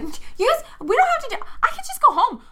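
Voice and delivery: very high, tearful begging; crying plea. Acted. Carlos cries to the kids to let him go, tearful high plea. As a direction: whimper it through tears, pitch way up. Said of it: "he starts crying as he's like".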